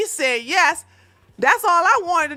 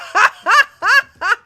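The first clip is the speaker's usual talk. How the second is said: big high laugh bursting out; loud burst of laughter; 8 semitones higher, 8 dB louder than usual. Real, not acted. Streamer reading chat, bursts out laughing. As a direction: crack up loudly at the chat.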